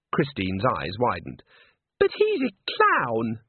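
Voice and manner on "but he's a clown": high, slow, incredulous; Christine scoffing. A narrator's reading. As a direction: stretch it out in disbelief. Christine dismissive of Walter.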